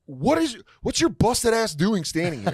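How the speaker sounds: high-pitched indignant comeback; retold exasperation. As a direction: go up in pitch, confronting the heckler. Retold quarrel with heckler.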